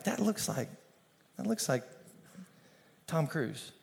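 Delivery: hushed gossip. Retold or imitated. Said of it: "you're kinda like".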